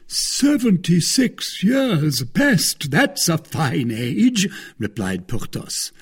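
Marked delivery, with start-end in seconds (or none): 0.1-4.5 high, slow, drawn-out marvelling; impressed surprise. Acted. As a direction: react with theatrical astonishment. Porthos reacts to husband's age.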